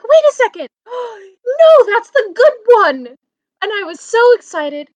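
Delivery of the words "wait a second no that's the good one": high, loud gasp of relief and joy; retold delighted realisation; joyful surprise. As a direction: gasp then cheer. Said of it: "I was like".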